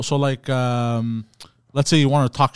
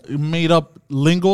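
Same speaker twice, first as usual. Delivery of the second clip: ordinary delivery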